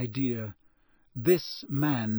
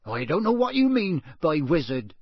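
louder, gaining nerve; steadying defiance; 3 semitones higher, 5 dB louder than usual. A narrator's reading. Intruder answering the cold voice, growing steadier. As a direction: stand your ground, voice firming up. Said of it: "his voice growing steadier".

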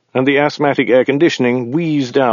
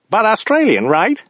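high voice for the driver; driver character; 12 semitones higher than usual. A narrator's reading. Driver assuring his passenger, high character voice.

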